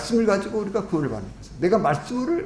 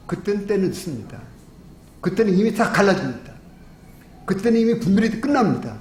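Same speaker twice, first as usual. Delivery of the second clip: higher-pitched, emphatic sermon delivery; forceful preaching; 4 semitones higher than usual. Real, not acted. Preacher warning the congregation of judgement, forceful preaching. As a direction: drive each warning home to the congregation.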